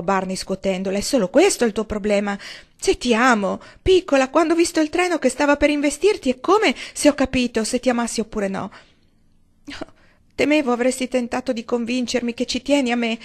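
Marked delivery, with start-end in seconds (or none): 1.0-8.7 higher, louder heated declaration; passionate insistence. Acted. Barney insisting he loves her. 10.3-13.2 higher, louder impassioned outcry; passionate cry. Acted. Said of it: "gridò Valency con passione".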